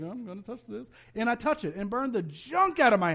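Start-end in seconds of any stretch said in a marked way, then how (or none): 0.0-0.9 much softer, sneaky dare; whisper-close taunt to mom; a quiet, cheeky challenge; sly defiant kid. Retold or imitated. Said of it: "I'm like".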